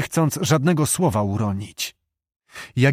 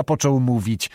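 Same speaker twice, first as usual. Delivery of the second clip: usual speaking voice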